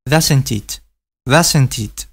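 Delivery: "Doesn't it" run together as one word; linked words. Shown as a demonstration. Teacher demonstrates linking to Spanish learners. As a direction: blend "Doesn't it" into a single unit. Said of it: "juntar estas dos palabras y pronunciarlas a la vez".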